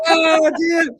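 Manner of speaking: very high, loud, laughing "oh dear". Real, not acted. Cohost still laughing at his joke, high and loud. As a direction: gasp it out through laughter.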